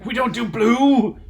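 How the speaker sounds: slow, high indignant protest; outraged disbelief. Acted. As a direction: spell it out, scandalized. In-character argument with father.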